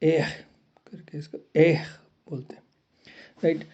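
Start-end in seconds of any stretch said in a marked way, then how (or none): 0.0-0.3 एह from the throat; guttural एह sound; French throat R. Shown as a demonstration. Teacher demonstrates French R from the throat. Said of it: "आर गले से निकलता है". 1.6-1.9 एह from the throat; guttural एह sound; French throat R. Shown as a demonstration. Teacher demonstrates French R from the throat. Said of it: "आर गले से निकलता है".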